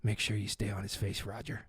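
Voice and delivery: low, very soft murmur; hushed producer aside; secretive instruction. Retold or imitated. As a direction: murmur an order to the crew on the sly. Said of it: "leans into somebody else and says".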